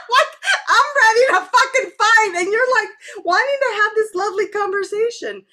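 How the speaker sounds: laughing. Real, not acted. Laughing at her own readiness for war.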